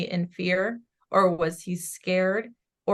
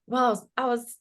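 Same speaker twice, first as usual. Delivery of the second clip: mildly surprised quote; 5 semitones higher, 5 dB louder than usual. Retold or imitated. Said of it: "he's like".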